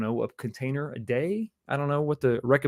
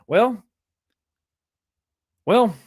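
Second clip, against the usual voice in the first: 1 dismayed surprise; 6 semitones higher, 7 dB louder than usual. Real, not acted. Reacting to Philly scoring.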